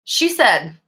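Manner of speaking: normal talking voice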